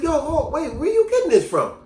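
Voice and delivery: high, baffled protest; acted confusion. Accused man asks girl where the rumor came from, baffled. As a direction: interrupt her, voice jumping up in bewilderment. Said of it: "I really was confused".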